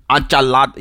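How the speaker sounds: ENCHILADA in a strengthened, put-on Mexican accent; exaggerated Mexican accent. Mocking people who strengthen up the accent, ENCHILADA in hammed-up Mexican accent. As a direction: overdo the Spanish accent on ENCHILADA. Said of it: "strengthen up the accent".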